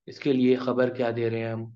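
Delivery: normal talking voice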